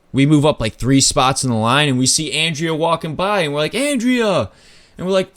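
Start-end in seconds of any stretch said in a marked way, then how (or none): 3.8-4.5 high, ringing call across the crowd; calling out a name. Retold or imitated. Friends spot Andrea walking past the line, call out to her. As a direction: hail a friend passing by.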